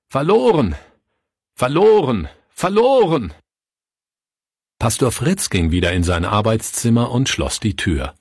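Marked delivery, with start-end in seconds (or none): 0.1-3.3 each verloren climbing higher in anguish; despairing lament. A narrator's reading. Pastor Fritz lamenting his lost children, rising anguish. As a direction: wail it out, building to the top.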